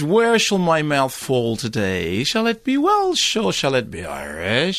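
slow, swooping mock Welsh-Irish accent; Anthony Hopkins. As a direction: let the accent wander between Welsh and Irish. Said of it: "he doesn't really do accents".

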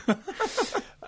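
a sudden high squeal of a laugh. Real, not acted. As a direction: burst into a high-pitched laugh. Laughing at Jonathan Pryce story.

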